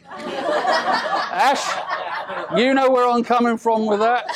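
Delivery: chuckling through the words; laughing while teasing the groom. Real, not acted. Laughing at own toenail joke.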